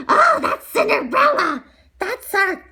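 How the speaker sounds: high, loud, shocked cry; shocked shout. Acted. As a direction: shout it out in disbelief. Stepsister recognizes Cinderella.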